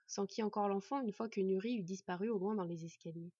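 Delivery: usual speaking voice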